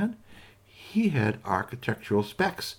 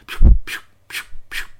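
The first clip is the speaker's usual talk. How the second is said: whispered pew pew effect; breathy pew pew noises; pew sound effect; 10 dB louder than usual. Acted. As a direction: spit out the little falling sounds. Sound of acorns dropping.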